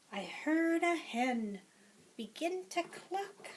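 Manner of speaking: lively, sing-song picture-book read-aloud; reading to children. Acted. Teacher reading a picture book to preschoolers, lively and sing-song. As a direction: read it to a class of little ones.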